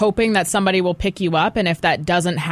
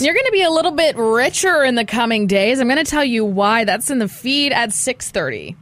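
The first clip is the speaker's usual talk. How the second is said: radio teaser; 6 semitones higher than usual. Acted.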